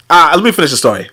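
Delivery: usual speaking voice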